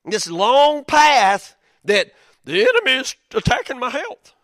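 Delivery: impassioned preaching. Real, not acted. Preaching climax about the enemy.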